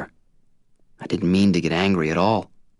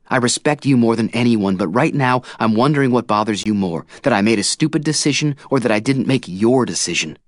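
louder, heated retort; 5 semitones higher, 4 dB louder than usual. A narrator's reading. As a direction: push back hotly. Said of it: "I say hotly".

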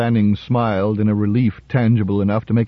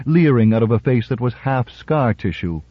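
ordinary delivery

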